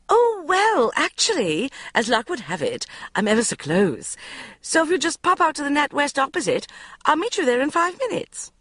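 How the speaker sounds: high, rushed mother; mother gabbling a hasty plan. A narrator's reading. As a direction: tumble the words out. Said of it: "she gabbled".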